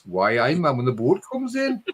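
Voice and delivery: a quick playful Geordie impression; Geordie accent. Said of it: "you got the Geordies".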